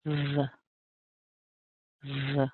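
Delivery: "V" as a softly buzzed "vuh"; soft isolated "V" sound with weak vowel; first syllable demo. Shown as a demonstration. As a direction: hold the v briefly, then a tiny "uh". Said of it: "So here's the first syllable".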